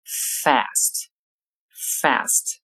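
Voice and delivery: "fast" with a flat front "a", as in "cat", American style; American pronunciation. Shown as a demonstration. Teacher contrasting American "fast" with British, flat front vowel. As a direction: say "fast" the American way, mouth spread. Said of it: "到美式英语中发作fast".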